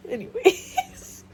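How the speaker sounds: high, slow, laughing anyways. Real, not acted. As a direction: laugh the word out to move on.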